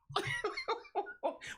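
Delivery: very high-pitched giggle; short burst of high laughter; laughing. Real, not acted. Laughs after calling it an appetizer.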